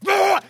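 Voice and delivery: loud high yell to scare; shout at dog. Retold or imitated. Scaring the dog off.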